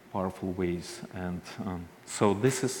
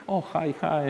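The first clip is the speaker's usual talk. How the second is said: bright, higher hello to a celebrity; excited greeting; 7 semitones higher than usual. Retold or imitated. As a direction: gush a little, starstruck. Listeners meeting the celebrity Ina.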